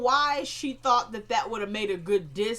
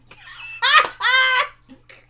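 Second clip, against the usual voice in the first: loud laugh; 13 dB louder than usual. Real, not acted. Narrator cracks up at the diss.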